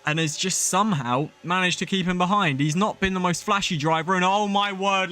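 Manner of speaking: ordinary talk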